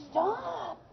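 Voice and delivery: high frustrated shouts of stop; imitating a kid snapping at noisy tablemates; mock frustrated yell. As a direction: play the annoyed kid yelling stop. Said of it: "you're going".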